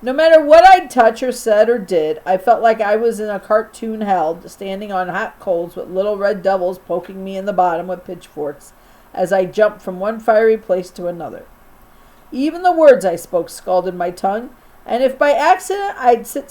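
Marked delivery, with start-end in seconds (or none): none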